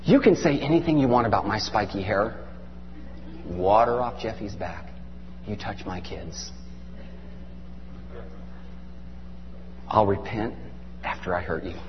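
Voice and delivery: low, soft warning, slowing at the threat; quiet menace. Real, not acted. Parent warning off whoever touches the kids, quietly menacing.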